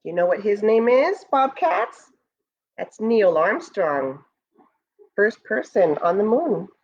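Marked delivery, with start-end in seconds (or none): none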